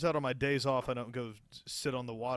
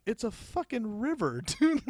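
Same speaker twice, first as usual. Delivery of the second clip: high, louder disbelief breaking into a laugh; incredulous; 10 semitones higher, 7 dB louder than usual. Retold or imitated. Coworker scoffing at the new guy's question, high and laughing. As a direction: scoff at how dumb the question is. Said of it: "He's like".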